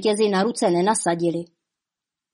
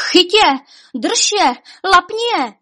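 high, loud shouted commands; frantic cries to catch them; panicked shouting; 10 semitones higher, 5 dB louder than usual. A narrator's reading. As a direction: yell short orders over one another. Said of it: "Křičeli jeden přes druhého".

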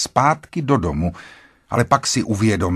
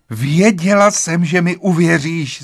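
relieved exclamation; 7 semitones higher, 5 dB louder than usual. A narrator's reading. Wife thanks husband for believing her, relieved exclamation. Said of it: "zvolala a zhluboka si oddechla".

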